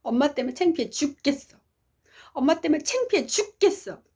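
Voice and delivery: high-pitched retold tantrum; angry child. Daughter throwing down her bag at home, angry at mother. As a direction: act out a daughter lashing out. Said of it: "있는 화 없는 화를 다 내는 거예요".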